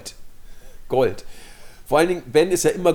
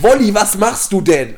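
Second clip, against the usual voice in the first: exasperated shout; 5 semitones higher, 8 dB louder, slower than usual. Retold or imitated. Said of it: "da platzt Ben der Kragen".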